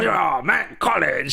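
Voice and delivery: high, hammed-up "Oh man, college"; mock character voice. Friend quoting a movie character, hammed-up. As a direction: toss it off like a goofy local.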